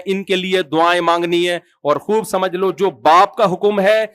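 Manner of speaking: ordinary talk, nothing marked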